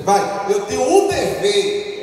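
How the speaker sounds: loud, slow call for the church to repeat. Shown as a demonstration. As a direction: lead the response, loud and slow. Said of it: "Repete assim comigo".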